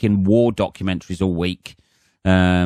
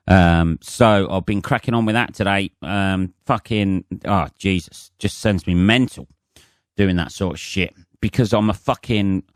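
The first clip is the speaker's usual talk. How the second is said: normal talking voice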